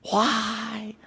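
soft, far-off lament of why; mock despair at the passage; quiet sighing why; dismayed groan. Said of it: "you just kinda go".

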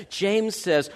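usual speaking voice

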